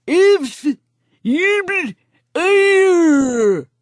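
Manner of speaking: high, slow, lips-still ventriloquist voice. Acted. Narrator does ventriloquism at shoppers, high and slow. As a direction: throw the words like a ventriloquist dummy. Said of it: "in my best ventriloquist voice".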